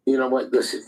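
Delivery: ordinary talk, nothing marked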